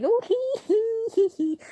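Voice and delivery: high, slow sheepish tittering; mom's guilty giggle. Retold or imitated. Imitating her mom giggling after feeding the dog horseradish. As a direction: giggle like you got caught. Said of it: "You laughing".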